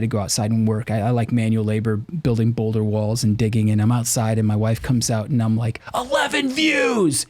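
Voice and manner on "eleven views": high, drawn-out mock yell; retold outburst. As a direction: holler it in exaggerated despair, stretching the words. Retelling his outburst to wife.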